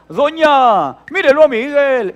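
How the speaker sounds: high, full-volume tattling holler; imitated child bawling for a grown-up; mock kid's shout. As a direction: yell out a complaint to the neighbour lady. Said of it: "le gritaba".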